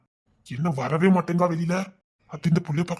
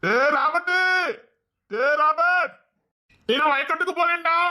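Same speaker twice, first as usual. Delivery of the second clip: high-pitched call to a friend; 10 semitones higher, slower than usual. Acted. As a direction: holler it out high, as a signal. Signals by calling out to friends. (உரக்கக் கூவி அழைப்பது)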